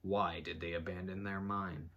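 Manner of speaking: usual speaking voice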